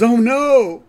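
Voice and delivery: high, loud "Oh no!"; alarmed cry. Retold or imitated. Grandpa hears bad news, cries out high and loud. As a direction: burst out in shock, high and loud. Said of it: "And then Abe goes".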